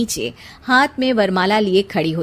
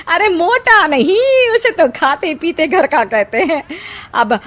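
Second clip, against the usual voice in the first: boastful aunt; 2 semitones higher, 5 dB louder than usual. A narrator's reading. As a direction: brag about the groom's family like a proud aunt. Aunt boasting about groom.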